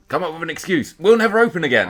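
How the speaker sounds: very high, very loud desperate outburst; frantic mock shout. Podcaster mocking the film's plot excuse, frantic high shouting. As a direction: cry it out like a hammed-up panicked excuse.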